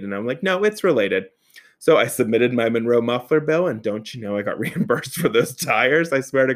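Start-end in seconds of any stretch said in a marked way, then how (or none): 0.4-1.3 high-pitched, emphatic insistence; insistent contradiction, voice raised in pitch; Patty Harding-esque. Retold or imitated. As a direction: push back firmly, pitch jumping up. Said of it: "a little Patty Harding-esque if needed".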